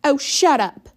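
high, loud annoyed snap; snapping. A narrator's reading. Brenda snapping at Ellie, high and loud. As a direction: snap at her sister.

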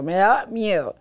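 ordinary talk, nothing marked

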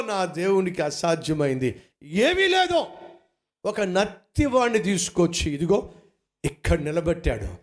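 ordinary talk, nothing marked

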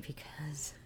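breathless hushed laugh; whispered giggle. Real, not acted. To viewers about her son's errand, giggling softly. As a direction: laugh under your breath. Giggling over God please be with them.

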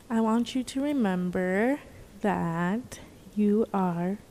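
slow, soft and sincere. Real, not acted. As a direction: say it gently, meaning every word. Sincere answer to friend.

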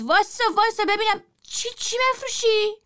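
high, anxious call from father; father calling out in alarm; worried call. A narrator's reading. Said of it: "بابام با دلواپسی داد زد". (صدا زدن نگران و بلند)

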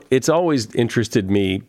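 normal talking voice

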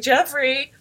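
a bright shouted name. Retold or imitated. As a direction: call it out like an entrance. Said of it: "he's like".

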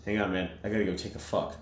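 ordinary talk

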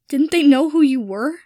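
the question breaking as tears come; voice shaking. Acted. Draco, upset about Harry's childhood, asks in a shaking voice. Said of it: "his voice shaking".

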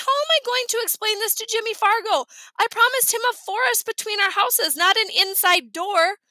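high, worried inner voice; fretting boy. A narrator's reading. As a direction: fret in dismay over a broken promise. Narrator voices Peter's alarmed thoughts.

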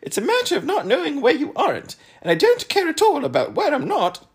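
very high, loud character voice; piercingly high contrarian; high-voiced know-it-all. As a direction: pipe it up loud, lecturing with confidence.

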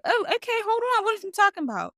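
high, flustered surprise; startled. Retold or imitated. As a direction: squeak it out like you were caught off guard. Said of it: "And I'm like".

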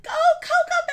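high, loud cheering shout; grandma cheering. Retold or imitated. Grandma shouting at her grandson's game, loud high cheer. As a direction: yell it from the stands like a proud grandma. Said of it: "She's like".

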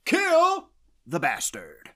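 slow, drawn-out military order; barked kill order. Retold or imitated. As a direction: imitate a sergeant ordering a kill. Sergeant relays an order from above.

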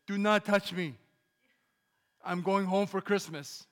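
loud, high angry outburst, slowing at the end; furious loud warning; losing it. Retold or imitated. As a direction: blow up at the guards. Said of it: "your pastor sometimes loses it".

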